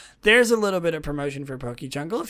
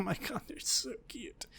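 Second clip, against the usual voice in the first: higher, whispered gush of adoration; squealing whisper; 5 semitones higher, 14 dB quieter than usual. Real, not acted. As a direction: whisper it, almost squeaking with delight. Overwhelmed by cute plushies.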